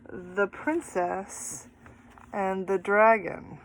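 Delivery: drawn-out, slow reading of The Princess and the Dragon; slow title read. Acted. Teacher to kids, announcing the title slowly. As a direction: savour each word of the title.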